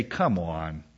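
low, soft, unconvinced reply; mock exasperation. Believer answering the friend's objection, mock exasperated. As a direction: drop the voice in weary disbelief.

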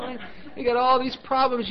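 high, put-on complainer's voice; mock whining. Preacher mimicking believers listing their problems, mock whining. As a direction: whine it high, mimicking a moaner. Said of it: "we wanna whine".

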